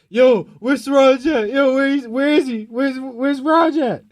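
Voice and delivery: searching shout. Retold or imitated. Cousins storming the party looking for Raj, loud high shout. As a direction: holler it like a raid team hunting him.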